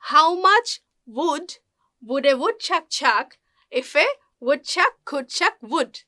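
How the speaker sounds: very slow, broken into small chunks; word-group by word-group with pauses; teacher modelling a tongue twister slowly; slow practice pace. Shown as a demonstration. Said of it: "প্রথম দিকে slowly practice করলেও".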